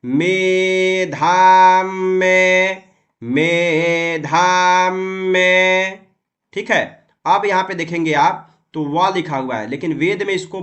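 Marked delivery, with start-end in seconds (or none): 0.0-6.0 मेधाम में chanted very slowly, म held; slow demo. Shown as a demonstration. Teacher answering his own question on pronouncing मेधाम में. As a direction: draw every syllable of मेधाम में out. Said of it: "तो इसका उच्चारण क्या होगा".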